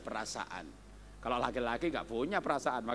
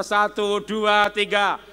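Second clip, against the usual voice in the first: loud count to start the reading; rallying count-in; 4 semitones higher, 9 dB louder, slower than usual. Real, not acted. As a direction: call the count for everyone. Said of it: "kita baca bersama-sama".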